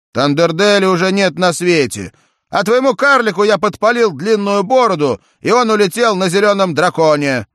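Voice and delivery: high, loud triumphant boast; proud hero. A narrator's reading. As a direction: brag about the win. Джек хвастается победой. (громкое торжество героя)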